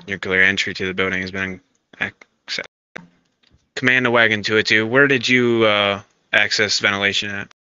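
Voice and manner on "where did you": ordinary talk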